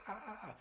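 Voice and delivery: high, soft mock cries of pain. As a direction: yelp softly as your arm gets twisted. Tony gets his wrist twisted.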